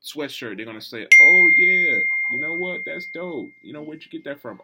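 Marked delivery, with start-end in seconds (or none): 1.2-4.6 excited fan. Retold or imitated. Imitating impressed fan seeing sweatshirt.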